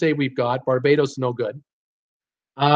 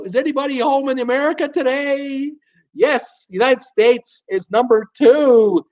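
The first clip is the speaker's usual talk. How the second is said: much higher and louder, mock disbelief; host shouting at chat that USA is number two; exasperated calling out; 8 semitones higher, 3 dB louder than usual. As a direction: call out to the chat, hello, anybody home. Host exasperated nobody guessed USA.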